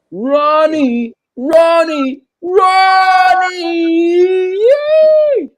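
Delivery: high, loud whooping cheer; real high-pitched holler; excited shout. Real, not acted. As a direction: yell the name out, stretching it longer each time. Calling out to friend, cheering.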